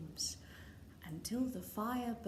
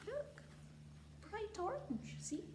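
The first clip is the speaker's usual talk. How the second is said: soft aside; 9 semitones higher, 10 dB quieter than usual. Real, not acted. Parent quietly showing the child the orange king. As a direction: point it out gently.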